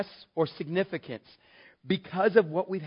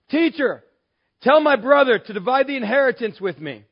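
pushy interrupter; 6 semitones higher, 9 dB louder than usual. Acted. Acted interrupter in the crowd.

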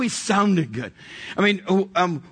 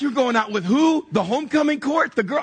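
high-pitched disbelieving questions; mock astonishment; incredulous questioning; 7 semitones higher than usual. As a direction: imitate stunned buddies, each question pitched up high. Retold roommates' disbelief.

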